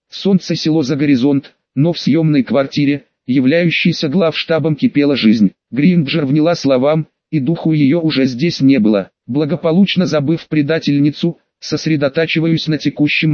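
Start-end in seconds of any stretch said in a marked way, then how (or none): none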